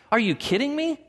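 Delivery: mock disbelief. Mock reaction to God's command.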